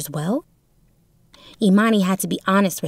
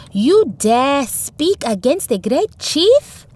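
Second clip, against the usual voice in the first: higher, louder slow outraged challenge; shaman shouting; 6 semitones higher, 4 dB louder, much slower than usual. A narrator's reading. Shaman rebukes the villager for defying the chief, shouting. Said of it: "he shouted".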